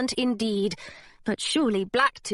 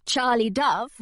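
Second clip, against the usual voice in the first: plain reading voice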